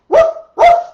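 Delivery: loud high woof woof; dog barking. Acted. Storyteller barks for the dog under the bed. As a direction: bark it big and high like a playful dog. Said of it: "the dog barked".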